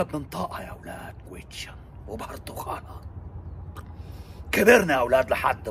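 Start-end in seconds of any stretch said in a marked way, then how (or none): 0.0-3.0 whisper. Acted. Secret of the wrong word.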